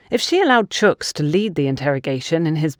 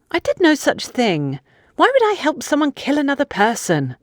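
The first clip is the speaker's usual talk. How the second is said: put-on higher, protesting young woman denying it; indignant young woman; 10 semitones higher than usual. Funke denying she helped plan a murder, indignant. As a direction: push back, offended at the very idea.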